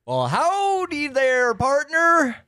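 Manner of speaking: high, drawn-out hammed-up southern howdy; southern accent. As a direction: put on an exaggerated, slow southern twang. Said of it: "I'm practicing my southern voice".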